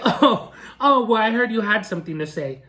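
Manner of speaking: mock chuckle, then feigned surprise; condescending sarcasm. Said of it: "very condescending and sarcastic tone".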